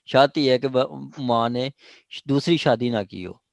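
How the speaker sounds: ordinary talk, nothing marked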